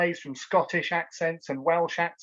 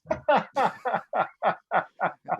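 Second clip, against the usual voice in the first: laughing; 4 semitones higher than usual. Real, not acted. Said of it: "There's the accent coming out for you".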